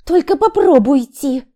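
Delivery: high, loud warning; wife in labour warns husband to stay; angry threat. Acted. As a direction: dare him to go. Wife threatens husband not to leave.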